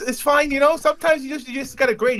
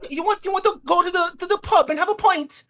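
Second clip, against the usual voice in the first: higher, put-on Liverpool accent; bad Liverpool accent; 10 semitones higher than usual. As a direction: try a pub-lad Liverpool voice and miss. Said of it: "Not even close".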